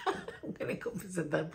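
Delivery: hushed laugh through the words. Real, not acted. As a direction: giggle it under the breath. Laughs about surprise visit.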